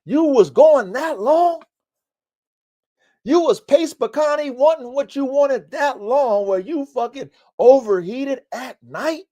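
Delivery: high, exasperated inner voice; common sense talking. Retold or imitated. To himself about the overheated dog, a high exasperated voice. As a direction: voice your common sense scolding you. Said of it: "common sense be like".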